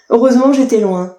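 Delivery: ordinary talk, nothing marked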